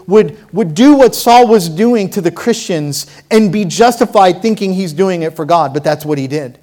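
normal talking voice

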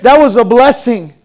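loud, slow, high sermon build; preaching at full force. Real, not acted. Pastor preaching to his church, loud and fervent. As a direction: preach it out to the congregation.

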